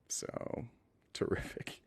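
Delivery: breathy whispered aside. Real, not acted. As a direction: whisper it to yourself. Whispered aside after caller.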